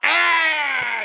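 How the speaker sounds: sharp high cry of fright; loud frightened yelp; startled cry. Acted. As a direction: cry out as the gun fires. Gunshot fires at Carver.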